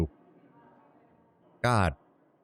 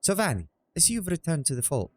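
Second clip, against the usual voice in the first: put-on high female voice, Latin accent not landing; 10 semitones higher than usual. Streamer trying Kalia greeting Savani, accent failing. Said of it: "it's not coming out Latin".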